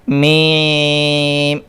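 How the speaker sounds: میم pushed through the nose, long and drawn out; nasal meem. Shown as a demonstration. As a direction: hum میم into the nose, the wrong way. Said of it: "غلط پڑھ کے بتاتا ہوں".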